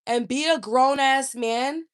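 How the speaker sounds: ordinary delivery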